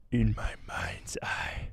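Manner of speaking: slow, breathy menacing whisper; Darth Maul whisper. Acted. Streamer doing Maul for a super chat, menacing whisper. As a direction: hiss it low and slow like a Sith lord. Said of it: "Should I try it again?".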